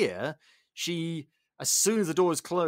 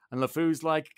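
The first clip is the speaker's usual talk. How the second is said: ordinary delivery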